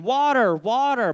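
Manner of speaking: high, loud panicked-style cry for water; shouting. Retold or imitated. Comedian re-enacts calling for water at a fire, shouting. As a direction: yell it out, drawing each word out. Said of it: "I started shouting".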